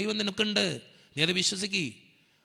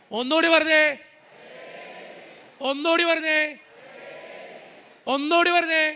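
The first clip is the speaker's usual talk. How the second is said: rousing call; 10 semitones higher than usual. Acted. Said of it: "leading congregation".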